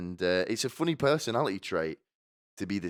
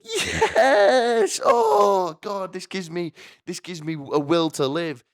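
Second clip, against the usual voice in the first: very high, loud cheer of joy; ecstatic cheer; 11 semitones higher, 7 dB louder, much slower than usual. Retold or imitated. Teachers cheering at a cruel plan, a high ecstatic shriek. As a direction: shriek with glee at the news. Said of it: "And they all go in".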